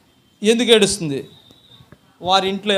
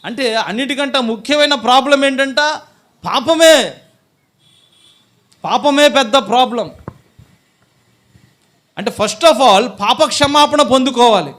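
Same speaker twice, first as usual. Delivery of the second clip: loud, raised, emphatic sermon delivery; forceful preaching; 6 semitones higher, 9 dB louder than usual. Real, not acted. Preacher telling congregation that sin is the problem, forceful preaching. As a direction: drive the main point home loudly.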